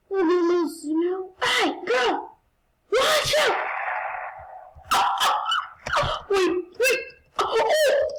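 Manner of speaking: high frantic yells; panicked shouting. Real, not acted. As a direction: scream it in panic. Panicked yelling during attack.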